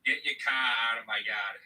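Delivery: Boston accent, R's dropped to broad ah. Shown as a demonstration. Performing a requested line for the stream, Boston accent. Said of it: "say in my Boston accent".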